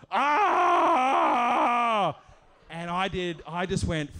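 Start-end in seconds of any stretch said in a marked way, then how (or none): 0.1-2.2 long, high, loud "aah"; mock wail of a man sprawled on the ground; imitated scream. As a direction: scream it out, drawn out. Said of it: "started going".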